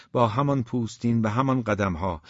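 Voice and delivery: ordinary delivery, nothing marked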